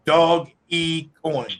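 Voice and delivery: "Dog E Coin" said slowly, each part separate; spelled out slowly. Shown as a demonstration. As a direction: break "Dog E Coin" into three slow clear parts. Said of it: "the real name is D O G E".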